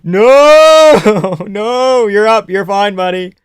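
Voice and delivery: high, loud no, then coaxing; hoping he recovers; anxious yelling. Real, not acted. Fighter struggling again live.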